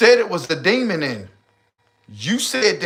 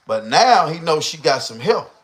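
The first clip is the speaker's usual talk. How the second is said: ordinary talk, nothing marked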